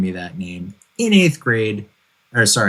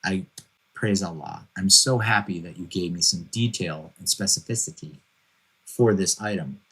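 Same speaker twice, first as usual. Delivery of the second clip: soft, dry sarcastic gratitude; mock-thank her under your breath; 6 dB quieter than usual. Husband sarcastically thanks wife for her detailed grocery list, quietly. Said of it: "And you're like".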